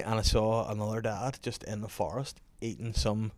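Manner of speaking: ordinary talk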